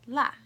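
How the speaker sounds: "la" short, falling sharply from high; fourth tone. Shown as a demonstration. Teacher showing the four tones, "la" falling. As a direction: drop "la" fast from the top. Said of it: "I'll say the four tones".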